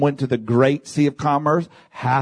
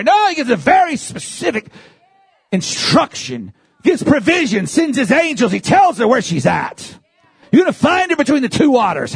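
high-pitched, driving sermon delivery; impassioned preaching; 10 semitones higher than usual. Real, not acted. Preacher urging the congregation on God's mission, impassioned high preaching. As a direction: preach it with rising fervor, pitch held high.

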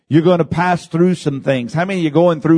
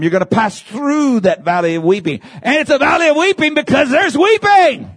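full-force preaching; 10 semitones higher than usual. Acted. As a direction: lift the whole church with it.

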